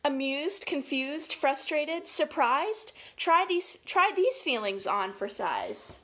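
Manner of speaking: put-on lilting, emphatic read-aloud for children; story time delivery; picture-book reading. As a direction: read each feeling word out clearly, with lift.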